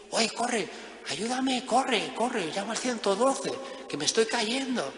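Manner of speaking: high-pitched, hammed-up pleading; mock urgent plea. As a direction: play a dangling climber begging for help. Acted climber begging for rescue.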